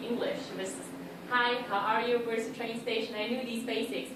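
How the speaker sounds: ordinary delivery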